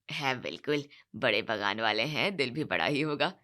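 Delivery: groom's relative counting on extra boxes; greedy in-law. Acted. Groom's side expects more mangoes.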